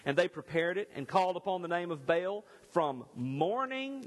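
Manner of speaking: measured, solemn Bible reading; scripture reading. Acted. Preacher reading Kings to his church, solemn and measured. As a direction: read the passage aloud with weight.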